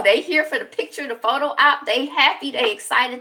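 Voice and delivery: high, loud and thrilled; hyped-up retelling of a celebration; excited celebration. Retold or imitated. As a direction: cheer them on like a proud family. Voicing the family's proud celebration.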